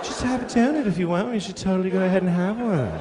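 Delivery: a hint of a carefree friend brushing it off; mocking skinny friend. Skinny friend tells the fat guy to just eat, mildly mocked. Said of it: "your skinny friend's like".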